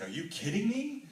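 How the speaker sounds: high, loud delighted are you kidding me; squealing with joy at the news; excited disbelief. Retold or imitated. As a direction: burst out in thrilled disbelief. Reacting to San Diego offer.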